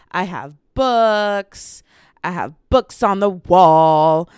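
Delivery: loud, slow, long-held final words; silly drawn-out announcing; goofy bellowing. Pretend. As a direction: boom it out and stretch the nouns. Goofily shows off her shelf.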